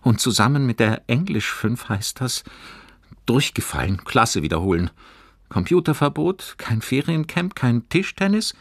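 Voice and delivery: put-on high boy's voice, climbing through the list; gloomy agitated boy. A narrator's reading. Fabian lists what failing will cost, dismayed. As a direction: count off each punishment in dismay.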